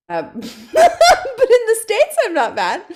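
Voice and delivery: laughing through the words, slowly. Real, not acted. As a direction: giggle it out, amused at yourself. Laughing at own accent skill.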